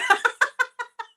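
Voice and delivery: loud, high squealing laugh; burst of laughter. Real, not acted. Host reacting to a joke about her mentor, burst of laughter. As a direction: crack up suddenly.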